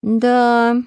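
frowning agreement. Acted. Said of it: "насупившись".

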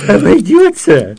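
high, loud scoffing echo; sardonic. A narrator's reading. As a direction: throw her word back with scorn. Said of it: "сардонически усмехнулся граф".

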